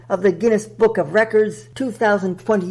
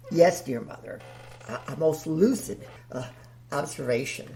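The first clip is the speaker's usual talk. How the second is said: lower, softer sheepish reply; deep, timid host; 6 semitones lower, 7 dB quieter, faster than usual. Acted. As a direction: shrink back in a low, hesitant voice.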